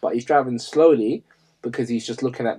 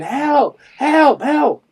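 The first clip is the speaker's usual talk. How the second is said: high-pitched mock cries for help, thrown up loud; imitating her screams; 13 semitones higher, 4 dB louder, much slower than usual. Retelling the panicked woman fleeing with her kids, mock screams for help. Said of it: "And she's screaming".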